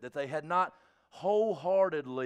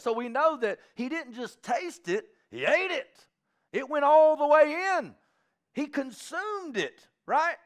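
rising preaching; 6 semitones higher, 6 dB louder than usual. Acted. Preacher builds on Ezekiel story.

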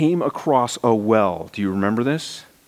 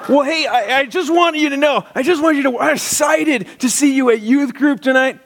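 overeager youth pastor; 13 semitones higher than usual. Retold or imitated. Young pastor phoning a junior high boy, gushing. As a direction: pour on the enthusiasm.

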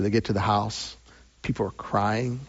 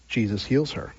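ordinary talk

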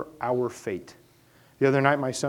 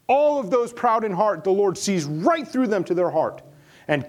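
louder and higher, driving each they have home; preaching at full force; 7 semitones higher, 6 dB louder than usual. Acted. Pastor thundering to the congregation about the proud, at full force. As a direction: preach it out, building with every line.

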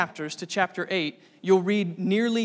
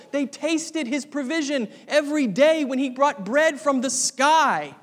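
louder, higher, building sermon climax; impassioned preaching; 5 semitones higher, 4 dB louder than usual. Acted. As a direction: drive it home to the congregation with fire. Preaching at full force.

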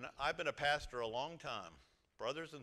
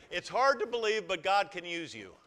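congregation call-and-response; 5 semitones higher, 13 dB louder than usual. Shown as a demonstration. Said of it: "Look to your right and say".

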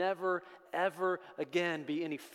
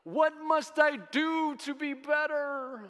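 high, loud, slow desperate pleading; desperate Paul; 13 semitones higher, 5 dB louder, slower than usual. Acted. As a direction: beg as a man trying to fix himself. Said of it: "he doesn't finish the sentence by saying".

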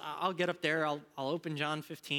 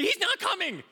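high, loud mock panic; panicked student; 9 dB louder than usual. Students retold as freaking out, high loud alarm. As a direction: cry it out like the sky is falling.

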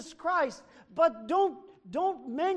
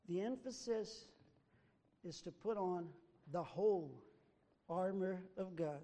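soft emphasis; 7 semitones lower, 13 dB quieter than usual. Acted. Restating main idea softly.

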